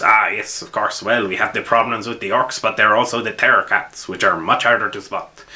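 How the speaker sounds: hammed-up French commissar; cheesy French accent. Commissar explaining the danger to new arrivals, cheesy French accent. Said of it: "I put on a very cheesy French accent".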